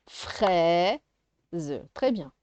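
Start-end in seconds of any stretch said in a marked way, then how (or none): 0.1-1.0 "Fraise" split into a long rising "frai" and a separate "ze"; syllable by syllable. Shown as a demonstration. Teacher sounding out syllables for a child, "Fraise" split in two. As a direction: stretch and break "Fraise" in two beats. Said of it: "de faire les syllabes".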